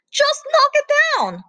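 high, loud gruff command; barking order. Acted. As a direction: bark it out. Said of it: "barked Plato".

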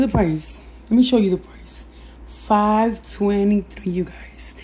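hoarse, fading whisper; raspy whisper. Real, not acted. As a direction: voice giving out, barely there. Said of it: "I'm sorry, I'm losing my voice".

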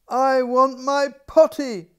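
high, loud, slow cry for the potty. A narrator's reading. Little princess loudly crying out for her potty. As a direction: princess calling out in urgent need. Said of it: "she cried".

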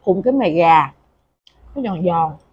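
chewing while talking; mouth full. Real, not acted. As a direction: food in mouth. Seen 5: Puts food in mouth at -4, chews while talking throughout.

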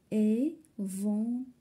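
Éventail slowly in three separate beats; syllable by syllable. Shown as a demonstration. Teacher locating the sound in third syllable, syllable by syllable. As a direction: draw out each syllable of Éventail. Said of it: "في la troisième syllabe".

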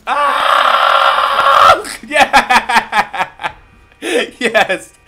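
screaming with laughing joy; triumphant shout. Real, not acted. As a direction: yell and laugh in victory. Kill in game, triumphant.

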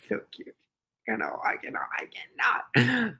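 whispered cooing; hushed adoration. Real, not acted. Owner overwhelmed by cute pet, whispering. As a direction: whisper it, overcome by cuteness.

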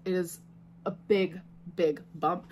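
usual speaking voice

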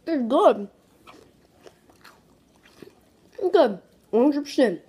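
talking between bites; chewing while talking. Real, not acted. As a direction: mouth partly full. Seen 0: Ate hot fries just before; lips pursed, mouth working while speaking.

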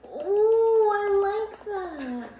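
high, slow delighted coo; drawn-out pleased oh; savoring a smell. Real, not acted. Reacts to smell.

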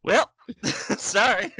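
slow laughing sorry; laughing sheepish apology. Retold or imitated. Lawyer impression, laughing.

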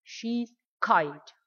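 She's kite with kite stressed; nhấn mạnh tính từ kite; stress on kind. Shown as a demonstration. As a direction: lean on the adjective kite. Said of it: "đọc mạnh hơn ở tính từ".

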